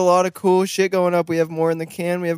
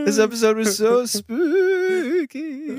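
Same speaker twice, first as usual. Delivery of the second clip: spooky voice; 7 semitones higher, slower than usual. Acted. Spooky Halloween outro.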